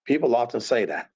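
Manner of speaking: ordinary delivery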